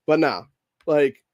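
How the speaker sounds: ordinary delivery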